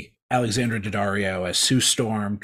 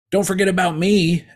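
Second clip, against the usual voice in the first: brash entrance; 9 semitones higher, 8 dB louder than usual. Retold or imitated. Johnny crashes through the ceiling.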